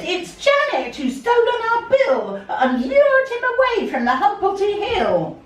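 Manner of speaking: hammed-up indignant blame; the Smoos blaming Janet, high and loud; accusing Smoos. A narrator's reading.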